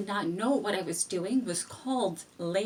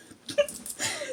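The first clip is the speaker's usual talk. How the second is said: squeaky high giggle; laughing at a memory before telling it. Real, not acted. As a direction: burst into a helpless high giggle. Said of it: "So I'm just laughing".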